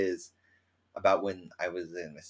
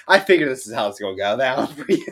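laughing; 4 semitones higher, 10 dB louder, faster than usual. Retold or imitated. Friend laughing.

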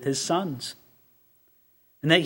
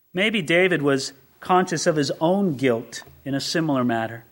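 normal talking voice

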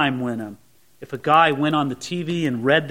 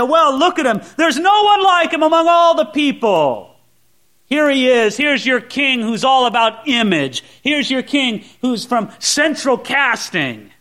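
sarcastic presentation; 6 semitones higher, 4 dB louder than usual. Retold or imitated. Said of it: "I'm sure Samuel said this sarcastically".

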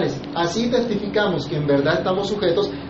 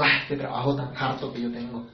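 imitated weary grumble, softer and lower, "solo" not stressed, whole line sagging; mock grumbling; 5 semitones lower, 7 dB quieter than usual. Preacher mimics a reluctant worker to the congregation, soft mock grumbling. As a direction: sigh it out like a worker who hates his job. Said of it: "refunfuñando, diciendo".

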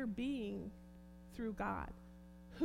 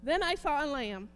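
loud, higher, fervent thanksgiving; preaching at full force; 7 semitones higher, 9 dB louder than usual. Acted. Preacher crying out thanks to Jesus before the church, fervent and loud.

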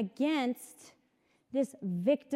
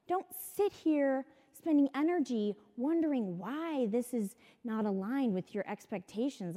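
ordinary talk, nothing marked

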